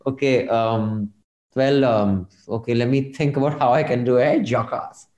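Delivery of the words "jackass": a lightly voiced Bollywood hero, quieter, spitting the insult; a hint of Anil Kapoor tossing off a filmy line; Anil Kapoor impression. Host obliging a listener's request with a mild Anil Kapoor insult. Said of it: "do an imitation of Anil Kapoor".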